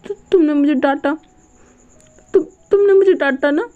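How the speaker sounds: high, stammering whine on the verge of tears; about to cry. Retold or imitated. Scolded boy whines, near tears, that he'll tell Gaga. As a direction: whimper like a spoiled child. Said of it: "जैसे अभी रो देगा".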